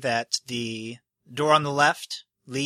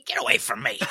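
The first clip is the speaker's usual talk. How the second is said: mock whisper; 10 semitones higher, much faster than usual.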